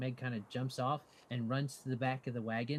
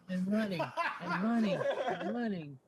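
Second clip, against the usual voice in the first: laughing narration; 6 semitones higher, 4 dB louder than usual. A narrator's reading. GM laughing at running creature.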